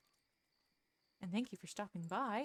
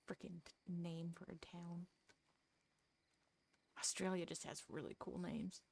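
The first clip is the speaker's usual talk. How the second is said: whispered aside; 7 dB quieter than usual. Real, not acted.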